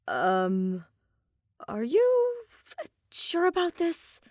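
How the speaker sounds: high, hesitant, unsure question; nervous young man. A narrator's reading. Blonde man doubting the guards, high and hesitant. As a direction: uncertain voice wavering up high. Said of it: "the blonde man asked".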